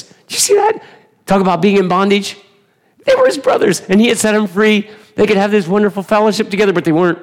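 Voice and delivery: high, loud, urgent preacher cry; fervent preaching. Acted. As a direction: preach it out at full force. Preacher erupts in high fervor.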